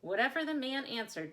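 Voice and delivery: usual speaking voice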